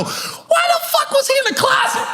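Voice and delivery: high, incredulous mock shout; bewildered yelled question; baffled outburst. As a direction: throw it up in disbelief, laughing. His own baffled question.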